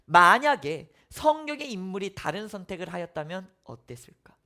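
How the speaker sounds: ordinary delivery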